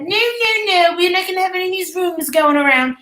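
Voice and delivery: high, insistent mock voice of the host; imitating her shutting it down. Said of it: "And she said".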